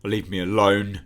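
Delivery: loud panicked shout to leave him alone. Acted. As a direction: yell it out, frightened and going insane. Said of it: "he just shouts".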